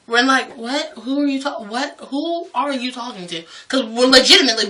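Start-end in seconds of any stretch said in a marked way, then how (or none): 0.6-3.4 hushed, baffled stammer; confused. Retold or imitated. Coworkers puzzled by the old lady's scolding, acted confusion. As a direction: break off and restart softly, totally lost. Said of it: "we were so confused".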